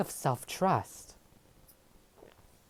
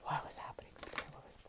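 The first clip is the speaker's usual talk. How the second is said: whispered question; 13 dB quieter, faster than usual. Real, not acted. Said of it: "I have to ask for clarifying".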